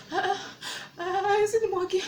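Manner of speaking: high, half-laughing, tearful protest; crying without tears. Retold or imitated. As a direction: wail it through a fake sob. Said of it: "I'm crying and I'm not crying".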